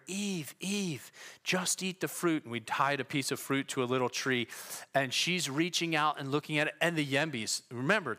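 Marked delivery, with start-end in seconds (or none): 0.1-2.4 lightly voiced tempting Satan; imitated stage whisper. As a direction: coax her with a hint of secrecy. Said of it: "we're whispering to each other, talking".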